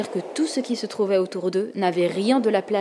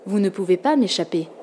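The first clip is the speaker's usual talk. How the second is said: usual speaking voice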